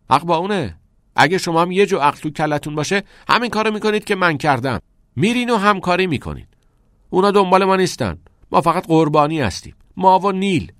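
loud, high, insistent argument; heated self-defence. A narrator's reading. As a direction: argue hard to win them over. Defends himself in heated quarrel.

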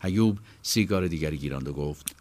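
usual speaking voice

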